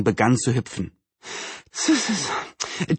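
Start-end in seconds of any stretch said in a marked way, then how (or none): 1.7-3.0 soft, slow hissed tut-tut; disapproving tutting. Acted. Neighbour watching a hurrying young man, disapproving tuts.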